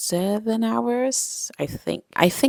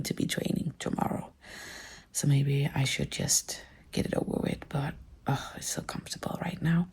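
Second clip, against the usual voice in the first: soft lazy whisper; 8 dB quieter than usual. Real, not acted. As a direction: whisper it, drowsy and comfy. Lazy, whispering in comfy bed.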